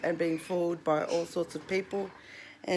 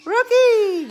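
very high, loud call of the name; 14 semitones higher, 12 dB louder than usual. Real, not acted. Owner mimics the parrot's all-day shout, very high and loud. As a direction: belt the bird's name out like he does. Calling the bird Rocky.

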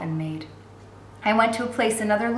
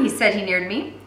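ordinary delivery

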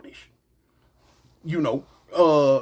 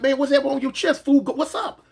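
heated challenge; 8 semitones higher than usual. Retold or imitated. Calling him out.

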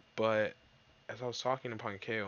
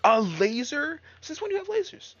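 much higher and louder incredulous cry; shocked shout; 11 dB louder than usual. Real, not acted. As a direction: blurt it out in disbelief. Enemy fires lasers.

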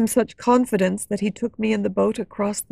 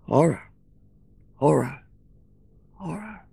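low, fading sad repetition; faraway sad echo; 7 semitones lower, 3 dB quieter than usual. A narrator's reading. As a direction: echo it mournfully from afar. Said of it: "A faraway voice sadly repeated".

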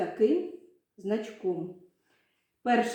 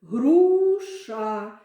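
"груша" stretched into two slow syllables, "гру-ша"; 5 dB louder than usual. Shown as a demonstration. Teacher has pupils count syllables with palms under chins, syllable by syllable. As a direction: draw out each syllable of "груша", the first longest. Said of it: "промовляємо слово груша".

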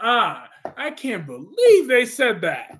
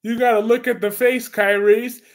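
ordinary talk, nothing marked

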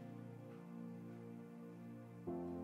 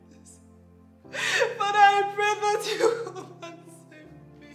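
louder, tearful, pleading cries; crying prayer; 4 semitones higher, 4 dB louder than usual. Acted. Wife praying for rescue from her marriage, crying out. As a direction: beg God to rescue you.

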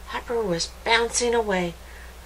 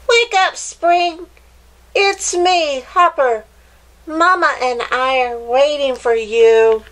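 high, loud wake-up call; 8 semitones higher, 8 dB louder than usual. A narrator's reading. Hopper calling into the cave to wake Spring, loud and high. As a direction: shout to rouse the sleeper.

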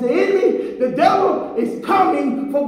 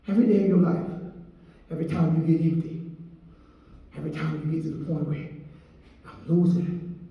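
low, much softer, fading; soft closing exhortation; 10 semitones lower, 8 dB quieter, much faster than usual. Real, not acted. Preacher closing the message, fading softly. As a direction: sink to a near hush to close.

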